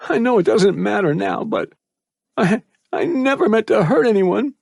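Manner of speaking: put-on boy confessing his regrets while crying; voice cracking. A narrator's reading. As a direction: confess through tears, voice breaking. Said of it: "his voice cracking".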